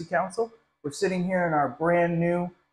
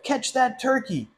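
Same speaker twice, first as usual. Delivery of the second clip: loudspeaker cry; 8 semitones higher than usual. Acted. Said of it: "the cry of".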